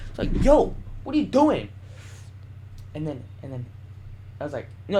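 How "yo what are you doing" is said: high, indignant retold challenge; mock outraged "back off" tone; acted confrontation. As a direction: call him out in a raised pitch.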